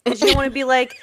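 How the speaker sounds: ordinary talk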